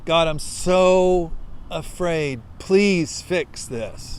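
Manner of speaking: slow, drawn-out plea to God; slow pleading prayer. Acted. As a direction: linger on each word, begging. End of frightened prayer.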